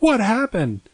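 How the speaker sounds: mom's worried question. Retold or imitated. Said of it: "she was like".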